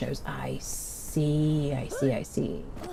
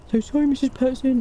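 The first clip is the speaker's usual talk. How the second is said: put-on higher, whiny teenage boy apology; 8 semitones higher, 3 dB louder than usual. Quoting the sketch's teen apologizing, whiny teen voice. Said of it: "who does that teenage boy's voice".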